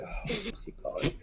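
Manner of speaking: breathy whisper of disbelief; whispered oh my god. Acted. Theron reacts to the bad roll, whispering. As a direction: mouth it in a whisper, embarrassed. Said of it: "Theron openly just kind of face, like".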